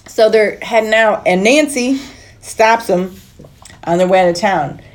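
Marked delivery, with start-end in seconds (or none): none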